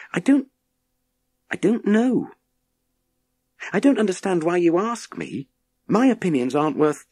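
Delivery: put-on a boy answering, unsure and hesitant. A narrator's reading.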